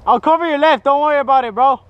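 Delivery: loud, high reassuring call; shouting to teammate. Real, not acted. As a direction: call it out over gunfire. Covering teammate in firefight.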